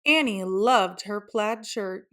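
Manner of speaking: ordinary delivery, nothing marked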